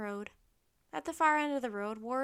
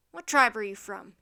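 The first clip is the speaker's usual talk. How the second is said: loud centurion interrogation; barked question; 2 semitones lower, 12 dB louder than usual. A narrator's reading. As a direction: bark it at the prisoners. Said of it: "he barked".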